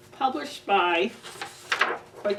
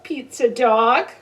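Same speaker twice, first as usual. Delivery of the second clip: louder, slow and playful; excited greeting; 8 dB louder, slower than usual. Acted. Reading aloud to kids, louder slow greeting. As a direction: greet the dog with glee.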